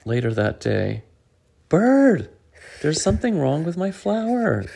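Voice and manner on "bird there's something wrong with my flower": high, loud worried cry; alarmed call. Acted. Squirrel worried calling.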